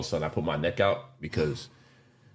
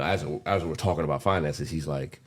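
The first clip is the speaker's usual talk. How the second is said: usual speaking voice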